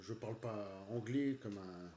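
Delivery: usual speaking voice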